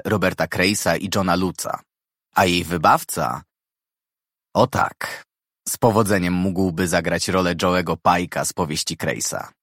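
plain reading voice

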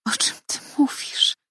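breathless crying whisper, pitched high; tearful whisper. A narrator's reading. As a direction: whisper it through tears. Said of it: "wyszeptałam". (płaczliwy szept)

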